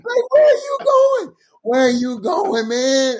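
high, drawn-out mock holler; incredulous call. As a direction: call after them in laughing disbelief. Acted calling after someone.

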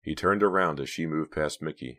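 ordinary delivery

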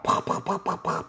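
voiceless popping gun noises; pop-pop shots with the mouth; mimed gunshots. Acted. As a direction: imitate rapid toy gunfire. Imitating gunfire in story.

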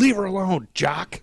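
high, loud retold yell. Arnie defending the runner from the official, yelling. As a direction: yell at him to back off, imitated. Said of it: "Arnie first started yelling at him".

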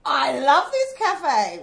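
slow, loud, gleeful; delighted. Real, not acted. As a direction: savour the delight. Delighted by the owner's defence.

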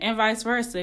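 ordinary talk

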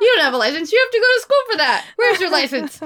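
laughing, high-pitched scolding. Retold or imitated. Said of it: "I was like".

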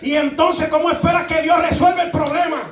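loud, high preacher's shout; preaching at full force. Real, not acted. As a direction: hammer the question home to the congregation. Preaching climax. (predicando a gritos)

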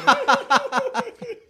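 loud high burst of laughter. Real, not acted. Telling the good looking story, loud high laugh. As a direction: crack up loudly.

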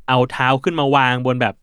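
ordinary talk, nothing marked

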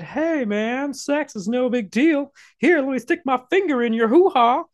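high-pitched put-on creep voice; mock casual creep. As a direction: parody an overfamiliar stranger making advances. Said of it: "That argument suggests that".

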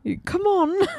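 high, put-on British coaxing; mock British accent. Playing along about fetching dad, mock British accent. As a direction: pitch it way up, hammy British.

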